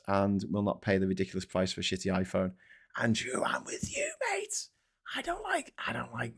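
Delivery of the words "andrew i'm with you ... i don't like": hushed conspiratorial whisper; a secret aside to a fan. Real, not acted. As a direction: lean in and whisper it.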